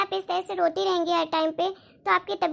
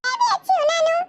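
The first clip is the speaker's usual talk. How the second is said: put-on much higher, childlike granddaughter voice; sweet little granddaughter voice; little girl voice; 10 semitones higher, 3 dB louder than usual. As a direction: pitch it up like a small girl greeting her nanu. Granddaughter answers her grandfather.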